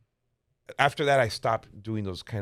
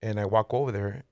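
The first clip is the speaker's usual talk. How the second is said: ordinary delivery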